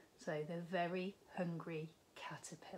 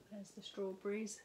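ordinary delivery, nothing marked

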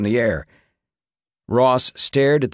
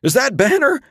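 high, loud, shaken question; trembling voice; 14 semitones higher, 4 dB louder than usual. A narrator's reading. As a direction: ask it while badly rattled. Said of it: "his voice trembling".

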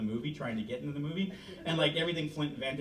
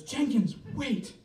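high call to stop him; a woman's raised high call; woman calling after him; 10 semitones higher, 3 dB louder, slower than usual. Acted. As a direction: voice her higher, calling him back before he leaves. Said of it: "And she goes".

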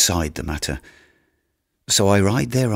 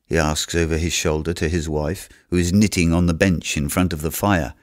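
ordinary delivery

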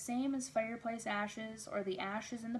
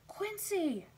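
high excited call, louder; scream; 11 semitones higher, 4 dB louder than usual. A narrator's reading. Narrator voices a child screaming for Quincy, scream. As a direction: cry out the name in a high scream. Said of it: "I screamed".

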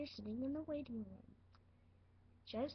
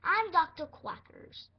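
slow, louder mock doctor voice; a second, more deliberate pretend doctor take; slow, loud, lightly voiced pretend doctor; doctor voice again; 4 semitones higher, 10 dB louder than usual. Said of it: "not my accent".

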